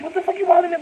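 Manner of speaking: put-on high, loud squealing girlfriend snap; mock angry girlfriend. Imitating an upset girlfriend who snaps at her man. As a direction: play the irritated chick, way up high. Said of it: "she just gets mad emotional".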